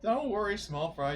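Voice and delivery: ordinary delivery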